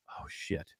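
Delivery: breathy whisper under the breath; a dread-filled whisper as the guard grabs it; whispered oh shit. Retold or imitated. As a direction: mutter it to yourself, barely voiced. Caught with gun stock at airport.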